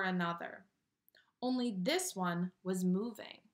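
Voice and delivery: put-on lively read-aloud to children; animated picture-book narration; storybook reading. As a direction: read it like bedtime story time.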